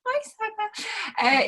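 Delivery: high, stretched-out sing-song hello; slow, high-pitched friendly greeting; drawn-out greeting. Real, not acted. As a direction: call out hello to a viewer, long and high. Host greets a viewer by name.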